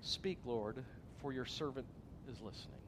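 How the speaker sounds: soft, reverent, fading to near silence; hushed prayer. Shown as a demonstration. Pastor shows congregation how to pray, softly. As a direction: model a quiet surrendered prayer. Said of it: "just simply say".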